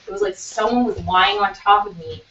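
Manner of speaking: usual speaking voice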